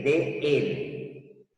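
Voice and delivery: "de él" said slowly, both words apart; "de él" drawn out, each word distinct before it becomes del; separated de él. Shown as a demonstration. Teacher shows the two words before contracting, slowly. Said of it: "Fíjense que".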